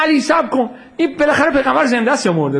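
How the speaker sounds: high-pitched acted call to an opponent. Enemy leader asks Ali whether the Prophet lives, calling out. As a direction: raise the pitch as if calling across a battlefield.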